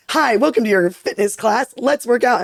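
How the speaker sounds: high, slow, upbeat fitness host; perky American trainer. Acted. American fitness trainer welcoming the class, perky. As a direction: greet the class like a cheery gym instructor. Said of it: "So the American would be like".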